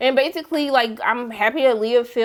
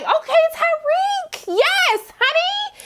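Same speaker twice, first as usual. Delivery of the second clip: much higher, slow, dreamy swoon; swooning admiration; much slower than usual. Retold or imitated. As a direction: melt over him like a smitten fan. Swooning over a TV hunk.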